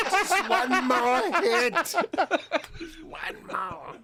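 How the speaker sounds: slow, high, pleading addict voice; junkie begging. Acted. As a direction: drag it out like a desperate junkie.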